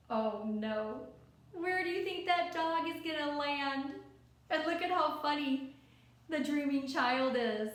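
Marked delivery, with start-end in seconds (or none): none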